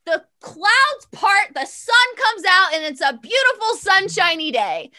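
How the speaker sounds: rising wonder as the sun breaks through; dramatic reveal. Real, not acted. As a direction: draw out the clouds parting like a miracle. Dramatic weather turn in story.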